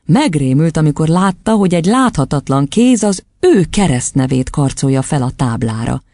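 usual speaking voice

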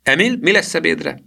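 put-on high-pitched little-girl question. A narrator's reading. Girl asking her cousin about lunch, in little-girl voice. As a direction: ask it in a high child's voice. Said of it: "mondta a kislány".

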